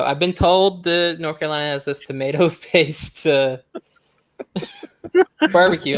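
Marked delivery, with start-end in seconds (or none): 2.0-6.0 trying to talk through laughter; real giggle breaking up the words; laughing. Real, not acted. Host explaining Carolina barbecue to co-hosts, laughing. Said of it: "I'm sorry, I'm laughing".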